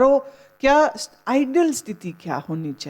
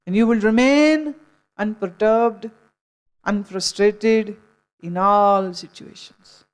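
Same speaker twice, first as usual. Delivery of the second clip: slow emphasis; much slower than usual. Real, not acted. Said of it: "slow emphatic English".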